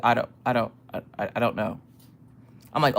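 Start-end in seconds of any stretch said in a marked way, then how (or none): 0.0-1.8 low, soft, stammering helplessness; imitating a stunned husband mumbling; shook husband. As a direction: back away from it, hesitant and quiet. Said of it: "he's shook".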